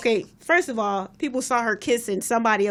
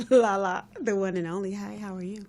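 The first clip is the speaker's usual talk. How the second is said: soft, low sexy purr; 3 semitones lower, 5 dB quieter than usual. Real, not acted. Host introduces herself to listeners, sexy and hushed. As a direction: go sultry, quiet and low. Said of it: "She went sexy on us".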